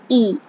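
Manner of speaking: ई said as a long ee vowel; vowel sound ee. Shown as a demonstration. Teacher demonstrates the zer sound, long ee. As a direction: say ई long and clear. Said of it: "जे की आवाज है".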